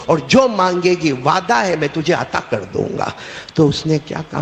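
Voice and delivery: ordinary talk, nothing marked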